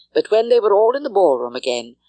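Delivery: ordinary delivery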